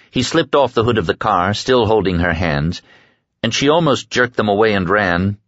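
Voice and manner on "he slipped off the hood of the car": usual speaking voice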